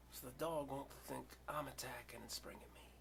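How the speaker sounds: voiceless whisper; whispering while frozen; hushed whisper. Real, not acted. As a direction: whisper so the dog won't move. Keeping still before the dog.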